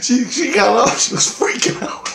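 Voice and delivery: high, breathy giggling delivery; can't stop laughing as you tell it; laughing through the words. Real, not acted. Telling a friend how she freaked out, laughing.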